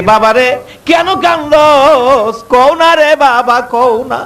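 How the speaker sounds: high, slow, drawn-out pleading; pleading mother. Acted. Mother begging her crying son to tell her. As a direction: beg the child tenderly to speak.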